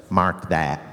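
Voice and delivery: low, laughing mark that; a hint of the director's low drawl; director approving. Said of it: "when he looks at the producer and goes".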